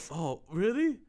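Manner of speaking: put-on astonished question; mock surprise. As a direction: imitate the old guy's rising reaction. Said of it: "And he's like".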